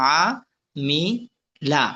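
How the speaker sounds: "আ, মি, লা" said as three isolated syllables; broken syllable by syllable. Shown as a demonstration. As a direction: leave a clear pause after "আ", "মি" and "লা". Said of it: "একটা একটা করে ভাঙ্গা উচ্চারণ". ("আ, মি, লা" ভাঙ্গা উচ্চারণে একটা একটা করে)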